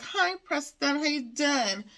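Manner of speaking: high-pitched, badly faked Valley girl accent. Recapper retrying the greeting to Preston, bad Valley girl accent. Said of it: "a Valley girl accent that I am unable to do".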